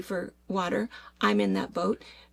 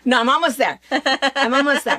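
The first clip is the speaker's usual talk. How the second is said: laughing, loud and higher; 3 semitones higher, 7 dB louder than usual. Real, not acted. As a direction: giggle through the protest. Laughing while being timed.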